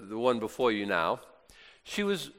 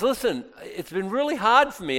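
ordinary talk, nothing marked

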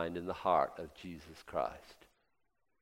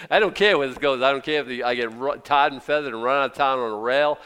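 louder, shouting it out from the pulpit; defiant preaching shout; 4 semitones higher, 11 dB louder than usual. Acted. Preacher to congregation, defying critics, shouting. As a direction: hurl it at the congregation, fearless.

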